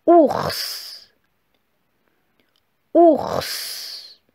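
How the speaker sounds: syllabe détachée, lente et nette, plus aiguë; dictée articulée. Shown as a demonstration. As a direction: dicter comme une maîtresse à l'élève. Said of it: "Alors c'est parti". (voix de dictée bien articulée)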